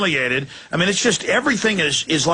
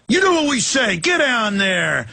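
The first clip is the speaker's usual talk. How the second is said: bossy thug; 6 semitones higher than usual. Retold or imitated. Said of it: "And so it's like".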